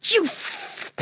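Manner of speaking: furious whispered cursing; angry whisper at YouTube; whispered curse. Retold or imitated. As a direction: hiss curses under your breath. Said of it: "I was just like".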